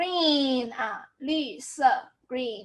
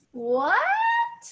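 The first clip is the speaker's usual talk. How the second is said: "What" drawn out, climbing very high; confused rising question. Shown as a demonstration. Teacher exaggerating a confused question, "What" soaring upward. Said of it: "when you're confused".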